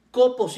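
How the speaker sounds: kopos stressed on its first syllable. Shown as a demonstration. Preacher spelling out a Greek word, kopos stressed on first O. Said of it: "con acento en la primer O".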